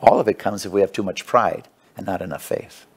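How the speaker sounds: ordinary delivery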